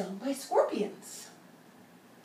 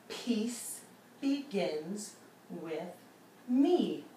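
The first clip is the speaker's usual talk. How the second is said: slow mantra; much slower than usual. Shown as a demonstration. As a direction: draw each word out like a mantra. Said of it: "touch your fingers ... as you say it".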